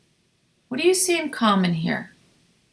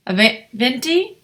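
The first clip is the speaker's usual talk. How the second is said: ordinary delivery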